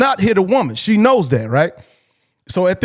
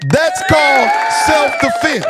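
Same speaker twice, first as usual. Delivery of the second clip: shouted self-defense; 6 semitones higher than usual. Shown as a demonstration. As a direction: hammer the point home with "self-defense". Repeating the point to men.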